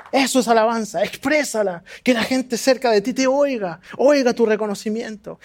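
ordinary delivery

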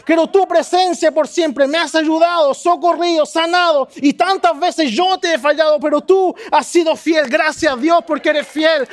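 praying aloud with swelling passion; fervent prayer. Shown as a demonstration. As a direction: pour out thanks to God with rising fervor. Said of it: "Que la abundancia de tu corazón diga".

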